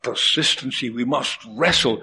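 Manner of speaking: ordinary talk, nothing marked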